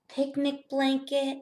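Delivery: ordinary talk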